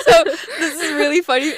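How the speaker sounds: high, loud giggling through the words; can barely get it out for laughing. Real, not acted. Host laughing as she starts the Olive Garden story.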